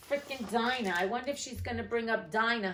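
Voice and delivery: ordinary delivery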